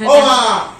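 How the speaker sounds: high, loud call across the house; loud cheerful call for mother. Real, not acted. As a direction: call mum to come over. Calling mother to see sweets.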